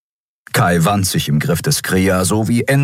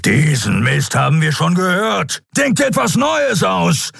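lion-like growl; 13 semitones higher than usual. A narrator's reading. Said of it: "löwenhaftes Brüllen".

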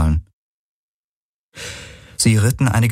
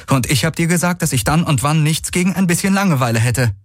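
calling back; 10 semitones higher, faster than usual. A narrator's reading. Rider retorts to her passenger about boredom, calling back. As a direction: throw it back over the gallop, pitched up. Retort called during the gallop.

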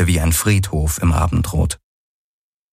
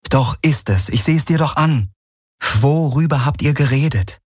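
higher-pitched, pressing character voice; insistent Kirai; 6 semitones higher than usual. Acted. Woman demands what the two men discussed, insistent.

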